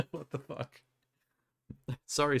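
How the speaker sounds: ordinary talk, nothing marked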